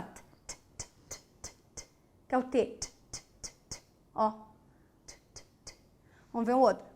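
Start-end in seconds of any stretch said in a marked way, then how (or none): 0.0-5.7 breathy unvoiced t sounds repeated very softly; whispered t clicks for the class; voiceless t demo. Shown as a demonstration. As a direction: tap out a silent t, t, t with no voice. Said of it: "Pensa lá".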